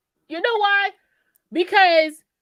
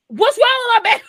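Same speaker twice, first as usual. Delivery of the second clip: loud, high mock-angry shout; acted indignant demand; 10 semitones higher, 7 dB louder, faster than usual. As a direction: demand answers like an alarmed mother. Acts out mother calling downstairs.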